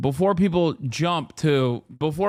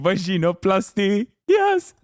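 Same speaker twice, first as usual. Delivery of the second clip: mock movie line; 4 semitones higher than usual. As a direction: imitate the doctor, yelp the yes. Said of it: "the lines "vaginoplasty, yes".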